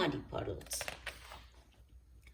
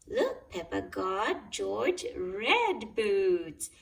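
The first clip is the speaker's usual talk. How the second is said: usual speaking voice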